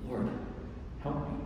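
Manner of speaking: very soft, pleading woman's voice; hushed plea. Acted. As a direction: beg quietly from the floor. Woman pleading at Jesus's feet.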